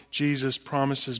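ordinary delivery

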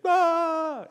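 high loud falling ah; Luke's falling scream. Acted. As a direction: scream as you fall. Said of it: "and then".

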